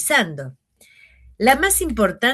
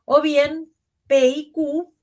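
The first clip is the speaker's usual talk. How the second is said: "o bien P y Q" said slow and raised, set apart; exaggerated grouping; 6 semitones higher, slower than usual. Shown as a demonstration. Teacher marking the grouping with voice. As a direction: read "P y Q" high and slow as one block. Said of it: "ahí quedó claro que la más importante".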